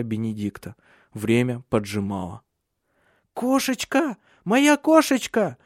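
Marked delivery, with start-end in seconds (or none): 3.4-5.6 put-on very high, drawn-out little girl's cry; child crying out. A narrator's reading. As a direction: call in distress for a lost pet. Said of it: "закричала Суль". (высокий детский крик, протяжно)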